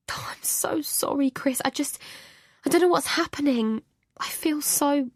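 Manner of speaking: high, tearful apology; crying. A narrator's reading. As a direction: plead through tears.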